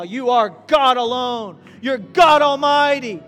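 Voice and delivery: prayer building to a loud shout; fervent prayer rising to shout. Real, not acted. Pastor praying before the church, building to a shout.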